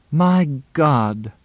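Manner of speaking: exclaiming. A narrator's reading. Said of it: "Moxie expostulated".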